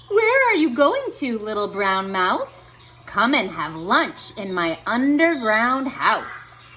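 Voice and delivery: smooth, sly fox voice. Acted. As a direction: tempt the mouse to lunch. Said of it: "A fox saw the mouse".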